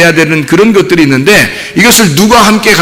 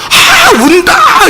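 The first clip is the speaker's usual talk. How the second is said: much higher, very slow delighted cry; delighted parent; much slower than usual. Retold or imitated. Preacher imitating a parent thrilled the baby cries, high and drawn out. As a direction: stretch it out in wonder, like a new parent. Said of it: "이래요".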